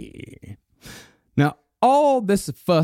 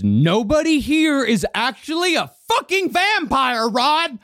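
1 retold yell; 9 semitones higher than usual. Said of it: "He yelled at Rod".

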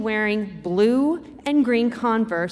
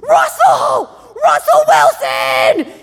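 screaming; 13 semitones higher, 10 dB louder, much slower than usual. Retold or imitated. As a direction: shout the name at full volume, up high then drawn out. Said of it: "is screaming at the top of his lungs".

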